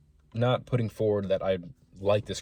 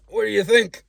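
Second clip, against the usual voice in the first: high, loud cheeky comeback; old man's retort; 12 semitones higher, 8 dB louder than usual. Retold or imitated. Old drinker answering the narrator, high and loud. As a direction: throw it back at him. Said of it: "He goes".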